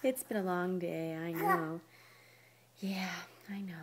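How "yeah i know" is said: soft whispered reassurance. Real, not acted. Parent whispering to a sleepy baby, soft whisper.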